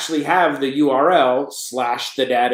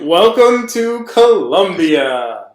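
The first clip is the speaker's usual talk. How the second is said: louder, slowly stretched announcer welcome; hammed-up grand welcome; 3 semitones higher, 6 dB louder, much slower than usual. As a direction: draw it out like a show host. Hammed-up welcome to the joke name.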